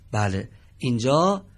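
ordinary delivery

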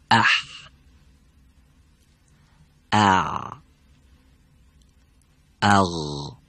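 ح, ع and غ sounded from the throat; letter sounds demo. Shown as a demonstration. Quran teacher to students, demonstrating each throat letter. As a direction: say اح then اع then اغ slowly, each from its own place in the throat. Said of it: "دقت بفهمید".

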